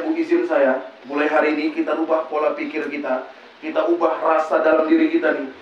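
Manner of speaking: ordinary talk, nothing marked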